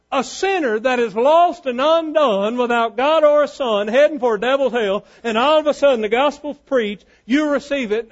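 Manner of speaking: high, ringing pulpit proclamation; fervent preaching. Acted. Preacher proclaims power in the gospel, fervent preaching. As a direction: hammer out each phrase to the congregation.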